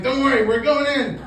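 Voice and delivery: excited reassurance. Retold or imitated. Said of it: "I'm like".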